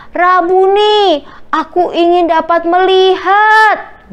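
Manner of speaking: high, slow, drawn-out appeal; acted beggar's pleading cry; earnest plea. A narrator's reading. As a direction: call out a wish with long stretched words. Blind man pleads with Jesus.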